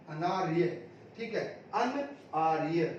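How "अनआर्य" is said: ordinary delivery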